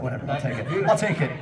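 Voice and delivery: ordinary delivery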